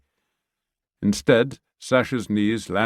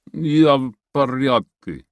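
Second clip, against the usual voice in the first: dazed Russian man waking up; groggy; 5 semitones higher, 5 dB louder than usual. Acted. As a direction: mumble it half-conscious. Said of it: "sounding groggy".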